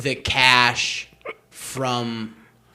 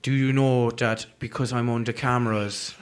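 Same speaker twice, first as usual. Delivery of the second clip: lightly voiced Irish accent, explaining; Irish clerk explaining the cameras; 2 semitones lower, 4 dB quieter than usual. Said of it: "doing an impression of an Irish comedian".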